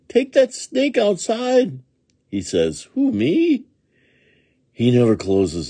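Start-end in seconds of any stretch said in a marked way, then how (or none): 0.1-1.8 high, slow, pleading cry; agonized plea. A narrator's reading. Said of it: "grown-ups plead the agonized plea". 2.9-3.6 put-on high, loud little-boy question; innocent boy. A narrator's reading. Boy feigns innocence to grown-ups.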